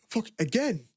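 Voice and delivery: high, incredulous jab from a factory lad; mock lad disbelief. Factory lad mocks his weekend plans, incredulous. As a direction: toss it off like a disbelieving workmate. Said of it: "lad banter".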